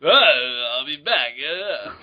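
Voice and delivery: slow, slurred action-star mumble; hammed-up slow boxer voice; Sylvester Stallone. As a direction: drag out the catchphrase with dopey grunts. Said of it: "Terminator Two starring Sylvester Stallone".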